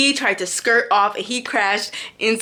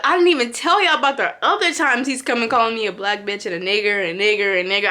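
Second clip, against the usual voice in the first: ordinary talk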